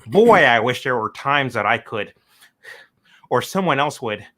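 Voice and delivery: loud, pointed repeat of the quote. Retold or imitated. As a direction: hammer home what he said. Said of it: "You heard it there".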